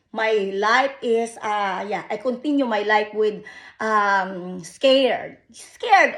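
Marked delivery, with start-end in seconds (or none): 5.5-6.2 anguished cry. Real, not acted. Repeats fearful word louder.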